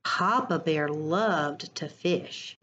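ordinary delivery, nothing marked

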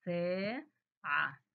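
ساعة drawn out with the ا held long; very slow stretched word. Shown as a demonstration. Teacher to children, stretching ساعة slowly. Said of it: "حرف السين ومديته".

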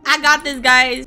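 normal talking voice

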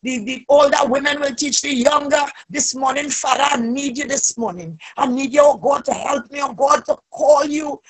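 ordinary talk, nothing marked

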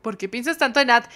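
normal talking voice

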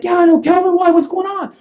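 high, agitated reply; acted alarm. Homeowner to the cop, agitated and confused. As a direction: answer in a high, panicky pitch.

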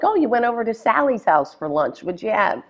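a hint of an Italian mother; lightly voiced mom. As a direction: ask like a doting mom.

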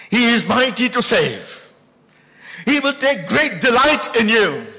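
loud, high, slow proclamation of scripture; preaching at full force. Acted. Preacher proclaims Zephaniah to the church at full force. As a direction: thunder the verses to the congregation.

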